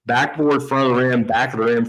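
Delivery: ordinary delivery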